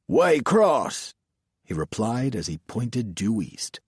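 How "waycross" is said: high, loud country lawman; Southern sheriff. A narrator's reading. Sheriff answering the driver and pointing west, Southern sheriff voice.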